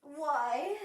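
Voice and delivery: ordinary talk, nothing marked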